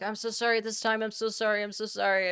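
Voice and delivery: high-pitched, repetitive mock apology; hammed-up grovelling assistant; apologetic Japanese Alexa. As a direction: plead high and fast-tumbling, over and over. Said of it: "she'd be like".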